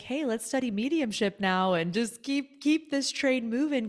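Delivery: gung-ho urging forward; eager inner voice. Retold or imitated. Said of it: "Just like".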